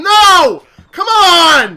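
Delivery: loud cry of protest; frustrated shout. Real, not acted. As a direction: yell it at the broken game. Protesting a game failure.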